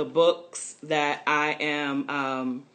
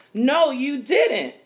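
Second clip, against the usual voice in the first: higher, louder and slow, chiming in; eager cry; 10 semitones higher, 7 dB louder, slower than usual. Acted. Market sellers telling Mama the truth, eager call. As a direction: cry out to set the record straight. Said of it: "cries Missus Ade".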